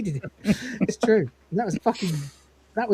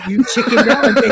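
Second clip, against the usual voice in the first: mock scolding dad; 2 semitones higher, 7 dB louder than usual.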